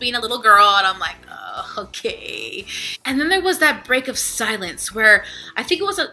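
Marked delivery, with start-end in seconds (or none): 1.3-2.6 low, soft, drawn-out okay; reluctant okay. Retold or imitated. Traveler reacting to a rowdy little girl, reluctant acceptance. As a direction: stretch it out slowly, unimpressed.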